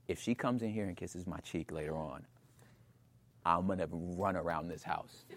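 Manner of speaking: hushed dread. Retold or imitated. Husband quietly warning himself in bed, hushed. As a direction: hold your breath and murmur it.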